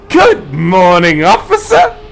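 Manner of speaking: high, bright, slow sing-song greeting, rising on officer; a cheery little boy hailing the cop; chipper kid greeting. Retold or imitated. Stepson cheerfully greets the leaning-in cop, high and sing-song. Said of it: "my stepson goes".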